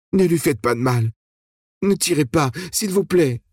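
beg, voice trembling; quavering voice. A narrator's reading. Ellie begging the guards not to shoot, quavering plea. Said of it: "Sa voix chevrottée".

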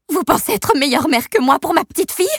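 high, loud outraged question; angry shout. Acted. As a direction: spit it out, offended. Amber shouting at the babysitter.

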